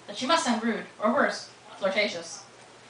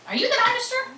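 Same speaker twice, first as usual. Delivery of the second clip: sharply higher, startled question; wide-eyed question. Acted. Tessa stunned, asking the strange boy. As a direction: blurt it out in shock, pitch jumping up. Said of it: "Tessa looked at him with wide eyes".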